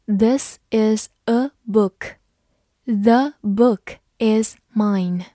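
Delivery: slow, word-by-word model reading; careful teacher's demonstration at half speed. Shown as a demonstration. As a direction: read slowly, leaving a gap after every word. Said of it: "我们慢读一遍".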